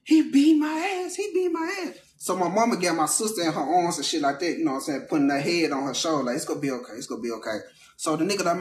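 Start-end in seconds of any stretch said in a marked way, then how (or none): 0.0-1.9 high, slow crying voice of the sister; sister sobbing. Retold or imitated. Sister crying to her brother, high and tearful. 6.4-7.6 mom soothing. Retold or imitated. Mom comforting crying daughter.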